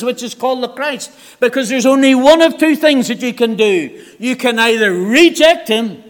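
preacher pressing the congregation to decide; fiery preaching. Acted. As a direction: drive it home from the pulpit. Preaching at full force.

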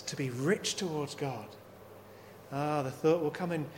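normal talking voice

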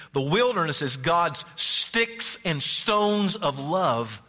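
P=A; slow emphatic. Acted. Echoes the story line.

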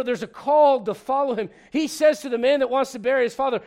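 ordinary talk, nothing marked

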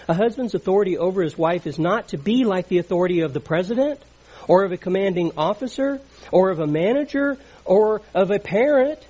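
preacher's crescendo from firm to loud; building emphasis. Real, not acted. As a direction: climb to a ringing peak on elder. Preacher builds through a list.

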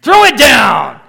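loud, high command; shout. Acted. Preacher echoing his dad's saying, a loud shout. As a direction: bellow it like a sheriff's order. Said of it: "My dad would say".

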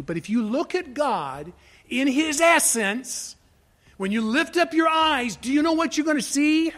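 rising high and loud toward the question; building fervour. Real, not acted. Preacher urging listeners to look at God, building fervent intensity. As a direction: lift the pitch and hold it up, pressing each phrase.

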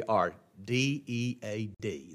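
are D E A D, each letter slow and low; low slow spelled-out dead; spelled out slowly. Shown as a demonstration. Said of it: "spelled out".

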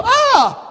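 high-pitched yelp on being struck; mock pained yell. Believer hit by the chastising rod, mock pained yell. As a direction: cry out as if whacked.

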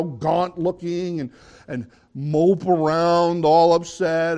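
preacher's loud slow build; preaching. Acted. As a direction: drive the warning home to the church. Preaching on the hypocrites.

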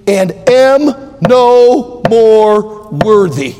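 solemn, drawn-out reading of the verse; solemn scripture reading. Real, not acted. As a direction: read the verse slowly and weightily.

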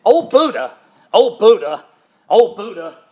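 mock angry exclamation, easing off; imitating mad. Preacher imitating his boss swearing by Buddha, mock mad. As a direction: act out an exasperated oath, punched briefly. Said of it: "he got mad".

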